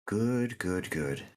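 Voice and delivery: lightly voiced approving unicorn; gentle unicorn voice. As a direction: praise them like a calm unicorn. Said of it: "the unicorn voice".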